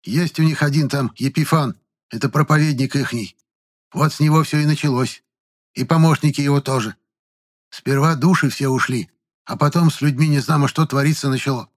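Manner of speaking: put-on high-pitched old woman's account; aggrieved old village woman. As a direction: tell it as an upset village granny. Old woman tells of sectarians.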